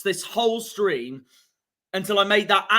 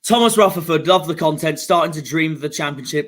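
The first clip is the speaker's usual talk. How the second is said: usual speaking voice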